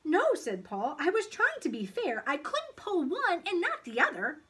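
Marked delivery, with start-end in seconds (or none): 0.0-0.3 put-on high, loud child's protest; earnest, higher boyish voice; defensive boy. A narrator's reading. Paul defends himself. 1.0-4.4 put-on high, loud child's protest; earnest, higher boyish voice; defensive boy. A narrator's reading. Paul defends himself.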